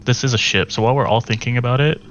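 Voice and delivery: usual speaking voice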